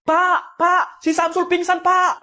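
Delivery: high, loud alarmed cry for the teacher; a student yells to the teacher that Samsul fainted; panicked call. Acted.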